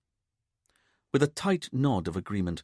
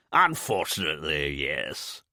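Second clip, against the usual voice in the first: patrician drawl; 4 semitones lower than usual. A narrator's reading. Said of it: "Rupert said in a patrician drawl".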